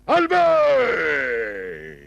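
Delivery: loud call across the house. Acted. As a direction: call Albert from the other room. Calling Albert from afar.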